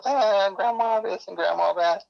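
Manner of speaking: put-on high, slow little kid voice; chirpy child talking to grandma. Voicing chubby kid.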